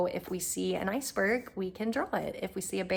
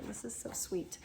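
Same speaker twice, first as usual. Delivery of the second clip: whispering; 10 dB quieter than usual. Real, not acted. Whispering over kids' art.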